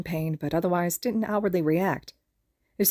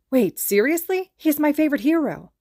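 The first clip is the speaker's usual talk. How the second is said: excited disbelief; 8 semitones higher, 5 dB louder than usual. Acted. Fan hears hero is villain.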